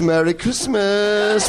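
slow, drawn-out ghostly greeting; mock-solemn Marley voice, slow and loud; imitating Alec Guinness. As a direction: stretch it out slow like a spooky ghost. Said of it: "at the end he goes".

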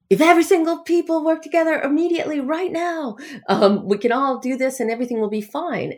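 high-pitched mock-cheery pep talk; false uplift. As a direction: parody a rousing, falsely hopeful sign-off. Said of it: "false seeming uplift at the end".

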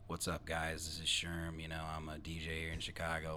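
low, soft self-parody of a podcaster; too-cool DJ. Retold or imitated. Host mimicking his first episode, too-cool DJ voice. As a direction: play it cool, dropped low and quiet. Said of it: "the inflection in my voice is like".

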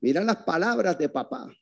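ordinary delivery

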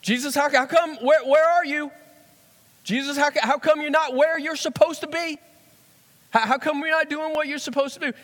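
high, loud, exasperated calling out to Jesus; urgent Peter. Retold or imitated. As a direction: push it like a panicked disciple hunting for him. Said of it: "you've used that tone with him before".

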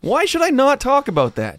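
loud, high-pitched exasperation; indignant challenge. Acted. Comedian challenging crowd that objects to joke, indignant. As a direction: throw the question back loudly and high. Argues back at offended crowd.

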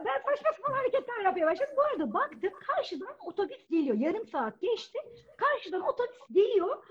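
ordinary talk, nothing marked

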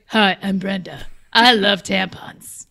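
slow, low raspy smoker voice; gravelly voice. Retold or imitated. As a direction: rasp it like an old smoker. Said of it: "And now she's like".

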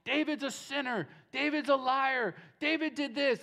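hammed-up heckler shouting charges; mock yelling. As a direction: throw the accusations at him like stones. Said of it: "he's just yelling".

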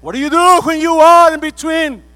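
preacher bellowing the question; shouted question. Real, not acted. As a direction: hurl the question at the room. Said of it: "same question, now shouted".

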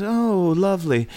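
high mock English accent. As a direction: imitate a pleased English guest. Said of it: "And he goes".

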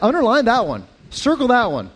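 usual speaking voice

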